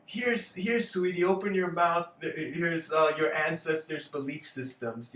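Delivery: high, loud mock parent voice; hammed-up parent feeding baby; coaxing parent. Parent spoon-feeding a child.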